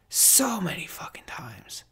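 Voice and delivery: slow hushed whisper repeating the thought. Real, not acted. To podcast listeners about near breakups, slow whisper. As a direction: let the words fall to an unvoiced, slow whisper.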